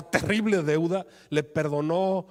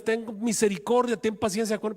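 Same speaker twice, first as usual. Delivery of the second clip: pleading; 6 semitones higher than usual. Acted. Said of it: "se ponía de rodillas".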